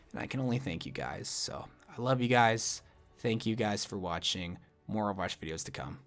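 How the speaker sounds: sincere thanks. Real, not acted. Soft heartfelt sign-off thanks.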